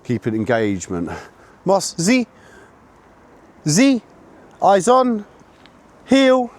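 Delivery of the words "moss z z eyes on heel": bright high-pitched call to the dog; high dog command. Real, not acted. Handler calling his puppy to heel, high and loud.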